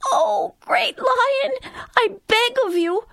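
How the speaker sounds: tiny high-pitched mouse pleading in fear; terrified little mouse voice; frightened mouse. A narrator's reading. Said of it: "cried the frightened mouse".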